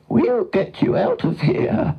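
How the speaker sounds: high, whinnying horse voice; whinny the promise and snort after it; reassuring horse. A narrator's reading. Horse promising the cow a rescue, whinnying.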